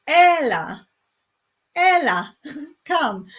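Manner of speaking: Ella called out high and loud, falling; calling Ella. Shown as a demonstration. Teaching viewers the Cypriot word, calling Ella like a mother. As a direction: call Ella like summoning a child. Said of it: "The next one is similar".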